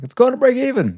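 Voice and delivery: sudden high, loud imitation; high-pitched mock quote. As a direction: squeal it as a mock reaction.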